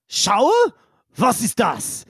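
puzzled question in a put-on German voice; confused character. Yigsel asked about a shower, mildly confused. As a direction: ask it baffled, in German. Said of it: "he kinda looks confused".

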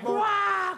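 crowd roar. Retold or imitated. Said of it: "the crowd's just like".

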